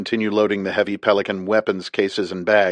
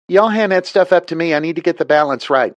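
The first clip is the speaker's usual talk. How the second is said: higher, louder southern woman calling down; southern pilot; 9 semitones higher, 4 dB louder than usual. A narrator's reading. Liz directing the men.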